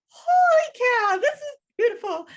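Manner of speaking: high, loud gushing outburst; over-the-top amazed squeal of delight; excited retold reaction. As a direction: reenact being blown away, pitch soaring and volume up. Retold awestruck reaction to music.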